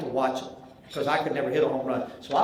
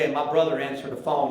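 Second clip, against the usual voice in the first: usual speaking voice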